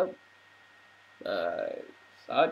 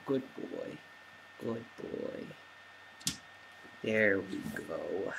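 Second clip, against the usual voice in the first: soft praise; 7 semitones lower, 11 dB quieter than usual. Real, not acted. Owner praising dog for lying down, soft and low. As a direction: murmur praise to settle him.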